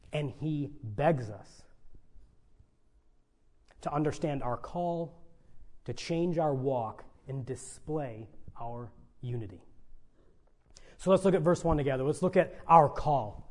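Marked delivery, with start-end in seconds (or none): 0.1-9.6 pleading hush. Real, not acted. Preacher drops to pleading hush.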